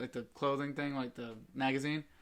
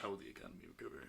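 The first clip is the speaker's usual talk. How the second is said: quick mock worry; 3 semitones lower, 12 dB quieter, faster than usual. Puppy worry quote again.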